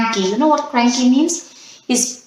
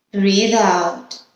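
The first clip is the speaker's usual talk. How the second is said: guided breathing cues; slower than usual. Acted. Leads breathing exercise.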